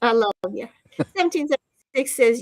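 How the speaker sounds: usual speaking voice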